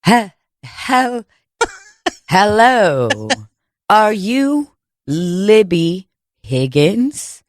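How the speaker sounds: halting start, then very slow, drawn-out words; posh Manhattan font impersonation. Acted. Would-be Manhattan font serving papers, slow affected voice. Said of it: "trying to be a Manhattan font".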